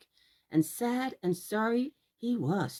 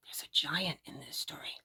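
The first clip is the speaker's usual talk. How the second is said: hushed storyteller whisper; spooky whisper; 5 semitones lower, 8 dB quieter than usual. A narrator's reading. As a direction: breathe it out like a secret. Storyteller building suspense for children.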